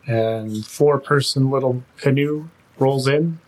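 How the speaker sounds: ordinary talk, nothing marked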